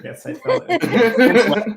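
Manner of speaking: slow, laughing mock lament. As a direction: play it up through laughter. Plays along with tears joke.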